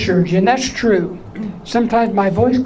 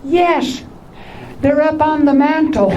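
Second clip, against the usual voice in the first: put-on child answer, high; 6 semitones higher than usual. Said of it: "Or I'll say".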